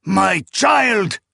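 high, loud wail; anguished acted shout; desperate cry. A narrator's reading. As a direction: cry out for the child. Mother pleading for her baby.

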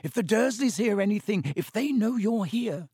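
high, urgent warning; frantic. A narrator's reading. Harry warns the elf about the Dursleys, frantic. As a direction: plead with rising alarm. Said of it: "Harry whispered frantically".

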